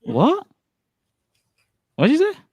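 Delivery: very high-pitched questioning squeak; high, baffled query; incredulous. Real, not acted. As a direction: shoot the question way up high in disbelief. Baffled by garbled statement.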